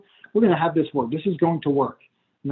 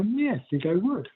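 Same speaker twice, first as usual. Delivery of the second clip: eager client, softer; 3 semitones higher, 7 dB quieter than usual. Pretend. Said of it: "And they go".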